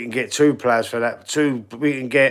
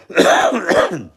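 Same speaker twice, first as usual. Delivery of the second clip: emphatic exclamation; 7 semitones higher, 5 dB louder than usual. Real, not acted. Emphatic answer after cough.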